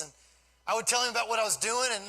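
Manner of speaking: usual speaking voice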